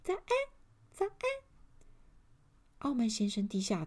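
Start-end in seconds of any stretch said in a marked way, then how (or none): 0.0-1.1 high, tiny elf voice; tiny thin voice. A narrator's reading. A hidden little elf calls to Mr. Arrogant, tiny and thin. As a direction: pipe up in a very small, thin voice. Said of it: "一个很细很小的声音".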